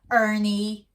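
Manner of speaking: warning. Acted. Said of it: "Gloria warned him".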